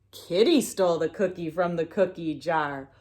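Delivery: ordinary delivery, nothing marked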